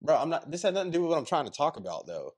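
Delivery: fast, exasperated push through it; frustrated rush. Real, not acted. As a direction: rattle it off impatiently. Frustrated, rushing to make point.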